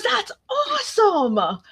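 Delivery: high, drawn-out fake joy; mock delight. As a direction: stretch it out, sarcastically thrilled. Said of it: "He goes".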